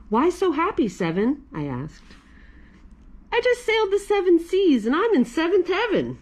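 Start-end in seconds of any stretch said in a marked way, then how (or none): 0.1-1.4 character voice. Acted. Voicing a character in a story. 3.3-6.2 bright, high character voice; cheerful story character, pitched up. Acted. Character answers in a voice.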